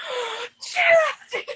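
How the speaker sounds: very high, loud lovestruck cry; swooning cry. Retold or imitated. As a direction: wail his name like a smitten fan. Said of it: "And Annika's going".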